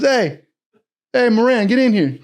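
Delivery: high-pitched summons; calling someone over. Retold or imitated. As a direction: imitate a chief hollering for an officer. Chief calling another officer in.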